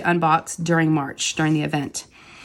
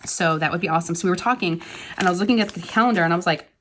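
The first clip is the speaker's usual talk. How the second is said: ordinary delivery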